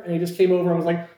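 ordinary talk

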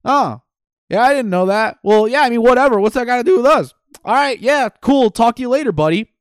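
high, loud, carefree dismissal; Ralphie shrugging it off. Retold or imitated. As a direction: brush it off like it's nothing. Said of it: "Ralphie's just kinda like".

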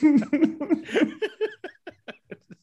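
high burst of helpless laughter; cracking up out loud; breaking character giggling. Real, not acted. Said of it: "I can't keep a straight face".

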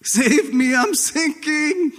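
high, desperate cry of a sinking man; acted drowning Peter crying out; panicked cry for help. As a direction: yell for rescue like you're going under. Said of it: "It was real".

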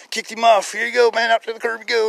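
high, loud hearty dismissal; brisk send-off. Retold or imitated. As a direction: send him cheerfully on his way. Acting out patient sent off.

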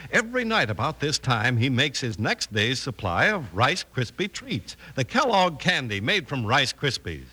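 usual speaking voice

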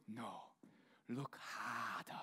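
low, slow, soft wise baboon voice; hushed Rafiki voice. Pretend. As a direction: drop low and drag it out like the old baboon. Rafiki line, deep and slow.

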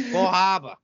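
mock Boston accent gone weirdly Canadian. Teasing his brother's accent slip, odd Boston-Canadian accent. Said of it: "Boston and kind of like, uh, like a weird Canadian".

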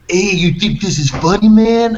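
high, loud confrontational challenge; angry street fighter. Retold or imitated. Brawler confronting an amused bystander, loud and aggressive. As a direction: challenge him loudly, pitch climbing at the end. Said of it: "this Mexican guy was like".

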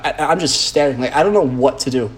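ordinary delivery

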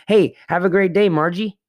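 high, bright sing-song greeting to a clerk; cheery goodbye. Shown as a demonstration. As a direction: chirp it out as you leave the store. Cheery greeting using clerk's name.

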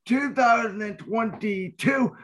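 booming ringmaster announcement. Acted. Host's grand show opening.

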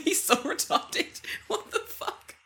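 laughing, slow and high; laughing disbelief. Retold or imitated. Telling a friend about the clumsy dog, laughing. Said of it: "And I'm like".

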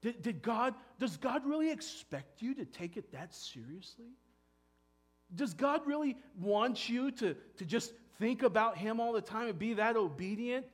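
the evil one needling, pushing each doubt further; tempter's mocking questions. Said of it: "here comes the evil one".

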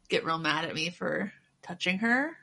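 normal talking voice

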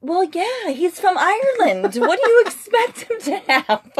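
high, drawn-out mock exasperation; incredulous retort. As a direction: retell it as if stating the obvious. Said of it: "And you're like".

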